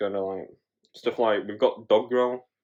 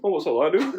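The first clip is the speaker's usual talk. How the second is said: higher, puzzled question with a laugh; sudden change of tone; 6 semitones higher than usual. Retold or imitated. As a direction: imitate fuming dad suddenly distracted. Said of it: "it's like a proper change of tone".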